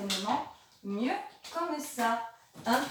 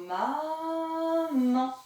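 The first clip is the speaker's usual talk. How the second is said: "Maman" with a long, higher maaa, then man; two stretched syllables; 4 semitones higher than usual. Shown as a demonstration. Teacher tracing two arches, "Maman" drawn out in syllables. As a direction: hold the first syllable of "Maman" high before releasing the second. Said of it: "Je viens donc faire deux ponts".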